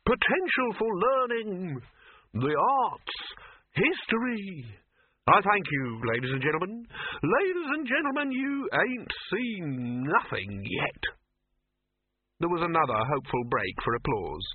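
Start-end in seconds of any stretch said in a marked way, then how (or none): 0.1-11.0 high, slow carnival barker; showman voice. A narrator's reading. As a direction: proclaim it like a grand showman. Showman continues pitch.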